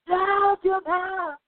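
high, drawn-out wail of protest; mock dismay. Retold cry of dismay at TV.

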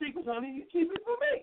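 high, hurt mock-husband voice; husband complaining to his wife, voiced high; upset husband. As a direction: whine it up high like a wounded spouse.

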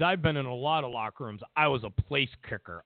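low, hurried imitation of a boast; mock-serious low voice; mock self-important man. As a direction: drop low and mimic his credentials. Imitating a politician's locker room boast.

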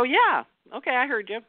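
high, pleasant yeah, okay; smiling noncommittal yes. Shown as a demonstration. Smiling at a request, high pleasant brush-off. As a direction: sound agreeable but promise nothing. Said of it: "it might make sense to smile and go".

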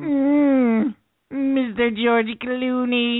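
slow, drawn-out nerdy announcer voice; Conan nerd voice. Pretend. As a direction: stretch the name out like a nerd. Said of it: "that was the Conan nerd voice".

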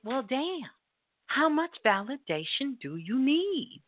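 usual speaking voice

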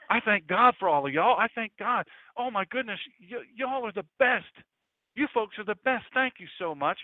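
put-on high, effusive southern accent. A disciple thanking his people, gushing southern accent. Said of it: "They had southern accents there".

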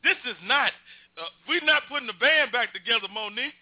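high, rising disbelief; high surprised excitement. Real, not acted. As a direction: realize who's calling, amazed. Recognizing another friend calling in.

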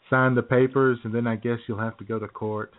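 ordinary talk

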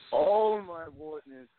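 jumps high on oh, hammed-up; drawn-out fake ecstasy; a campy high wail; mock moan. Caller acts out moaning.

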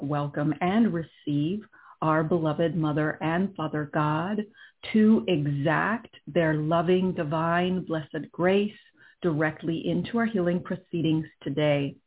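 calm and unhurried, guiding inward; right in your ear. Acted. Leads a guided meditation.

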